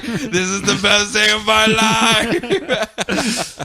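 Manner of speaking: slow, high line through laughter; laughing glee. Retold or imitated. Midnighter facing a hundred clones, laughing with glee. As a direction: savor the joy, laughing. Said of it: "And he's just like".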